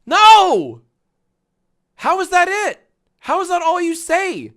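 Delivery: loud, high-pitched outburst of disbelief; exasperated shouting. Real, not acted. Streamer appalled by the reaction video, exasperated shout. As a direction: yell it in hammy exasperation.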